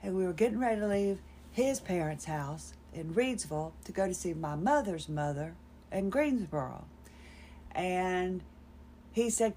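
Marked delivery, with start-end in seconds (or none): none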